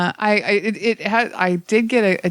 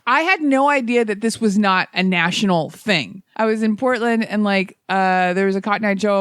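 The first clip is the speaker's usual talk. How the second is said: ordinary delivery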